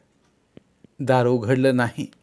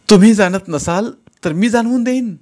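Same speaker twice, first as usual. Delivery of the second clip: loud, higher, challenging; defiant shout; 8 semitones higher, 8 dB louder than usual. Acted. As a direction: throw the threat back at him. Wife fights back.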